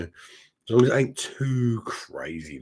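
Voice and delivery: ordinary talk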